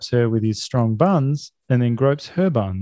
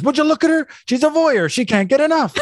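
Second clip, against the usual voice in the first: mocking Jack; 13 semitones higher, faster than usual. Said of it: "and then Jack says".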